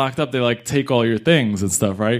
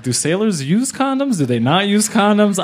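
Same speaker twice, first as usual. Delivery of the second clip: confused; 9 semitones higher than usual. Retold or imitated. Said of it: "I was like".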